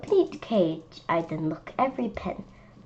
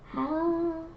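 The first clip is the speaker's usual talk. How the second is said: higher, softer wondering "How?"; puzzled; 8 semitones higher, 6 dB quieter than usual. Real, not acted. Child puzzling over the lion, high bewildered question. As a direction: ask in baffled wonder.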